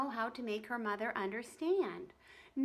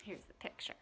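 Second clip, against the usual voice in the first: whispered aside; 3 semitones lower, 12 dB quieter than usual. Real, not acted. Reader shows the kids the picture, whispering. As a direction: whisper it while showing the page. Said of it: "aside while showing picture".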